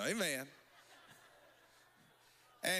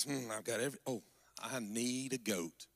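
musing Samson; 10 semitones lower than usual. Acted. Preacher playing Samson choosing a gift, low and musing. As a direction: think aloud deep down in your register.

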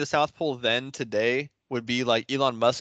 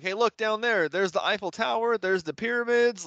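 higher, eager pretend sightseeing guide; fooling Jacob over Vegas with a higher, eager tour-guide voice; mock tour guide; 7 semitones higher than usual. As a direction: sell the fake sights with excitement. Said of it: "be like".

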